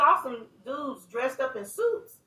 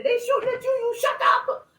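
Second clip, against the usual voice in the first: mock yell; 12 semitones higher than usual. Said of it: "And they said".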